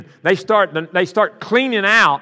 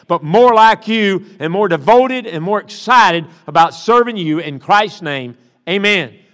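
slow, loud pulpit prayer calling on the Spirit; fervent prayer; 5 dB louder than usual. Real, not acted. Pastor praying over his church, fervent and slow. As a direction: pray it out over the congregation, stretching the words.

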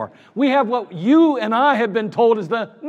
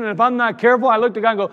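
ordinary talk, nothing marked

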